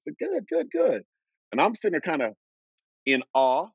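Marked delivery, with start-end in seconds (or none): 0.2-1.0 much higher, nervous, repeated good; retold starstruck reply; flustered good good. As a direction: blurt it out like an awestruck rookie.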